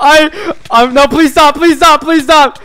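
loud, high frantic begging; desperate pleading. Real, not acted. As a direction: beg them at full voice. Begs attackers to stop.

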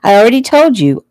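high, loud, impatient reminder; exasperated child. A narrator's reading. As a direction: protest like a child fed up with explaining. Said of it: "Joey rolled his eyes".

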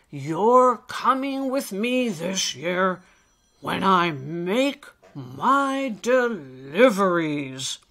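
loud, slow, emphatic encouragement; hearty old elf. Acted. Old elf offers Alistair a special job, hearty. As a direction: boom it out to build the little one up. Nicholas announces the plan.

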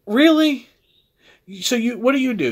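sharp high disbelieving "Really?"; incredulous. Real, not acted. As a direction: scoff at the writer, loud. Mocking the writer's wordiness.

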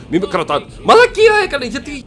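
mock furious yell. Gamer voicing angry Kratos at Hermes, hammed-up yell. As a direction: play it like being held back, yelling. Pretend Kratos breaking free.

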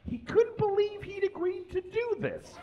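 a comic squeak of disbelief; squeaky high voice. Acted. Reader at a comedy night squeaking out the hero's disbelief. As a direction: jump way up into a squeak for the line.

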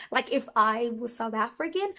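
ordinary talk, nothing marked

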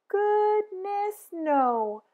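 high playful exclamation; emphatic cheerful denial; goodness no. A narrator's reading. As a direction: cheerfully brush off the idea. Answering did Pete cry.

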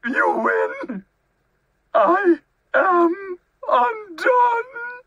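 high wail growing slow and loud; blubbering. A narrator's reading. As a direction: sob out total defeat, dragging the last words. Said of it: "he blubbered".

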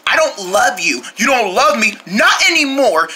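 ordinary talk, nothing marked

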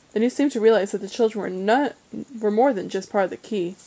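plain reading voice

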